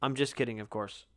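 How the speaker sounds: normal talking voice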